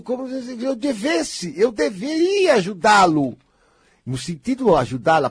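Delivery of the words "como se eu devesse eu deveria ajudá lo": mock indignant.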